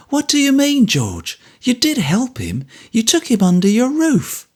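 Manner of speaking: high, indignant kindness; Mrs Bagnet, protesting. A narrator's reading. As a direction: insist he did help.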